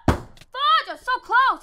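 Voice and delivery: high, very loud outburst of defeat; frustrated scream. Real, not acted. Gamer screaming after just losing a fight, frustrated. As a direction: yell it in anguish at a near miss.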